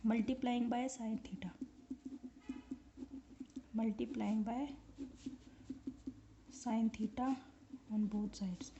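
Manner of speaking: soft, drawn-out words while writing; slow, quiet dictation. Real, not acted. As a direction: dictate slowly under your breath, pausing often.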